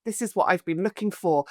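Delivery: soft, contented relief; blissful sigh. Retold or imitated. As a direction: sigh it out like finding home. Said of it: "I remember thinking".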